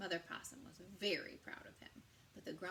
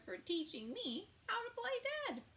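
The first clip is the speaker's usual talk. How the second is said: put-on much higher child voice; little possum voice; 13 semitones higher than usual. Joe thanking the bear then asking Mom for pie. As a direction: chirp it like a small eager possum. Joe's high voice.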